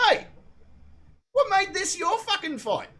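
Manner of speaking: high-pitched, confronting outburst; retold indignant challenge. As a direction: challenge them, voice pitched right up.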